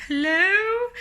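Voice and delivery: very high, loud questioning call; exaggerated baffled greeting. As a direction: stretch it upward in comic bewilderment. Second baffled call, more exaggerated.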